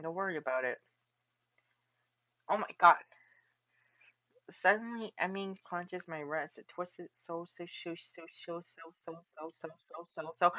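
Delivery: ordinary delivery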